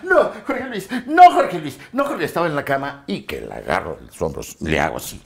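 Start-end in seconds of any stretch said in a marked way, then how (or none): 0.0-2.3 high, hammed-up frightened pleas; mock pleading victim. Actress resisting Jorge Luis in a scene, high mock pleading.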